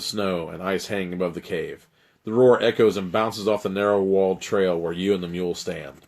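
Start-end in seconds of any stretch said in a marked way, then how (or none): none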